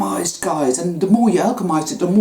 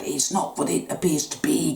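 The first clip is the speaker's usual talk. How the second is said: "not" leaned on, the rest dropped much softer; softer reminder to self; 6 dB quieter than usual. Shown as a demonstration. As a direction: repeat it quietly to yourself. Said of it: "I'm going to keep reminding myself of that".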